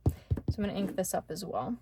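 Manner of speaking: ordinary talk, nothing marked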